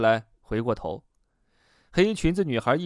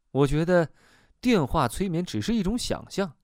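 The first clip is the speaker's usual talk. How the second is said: ordinary delivery, nothing marked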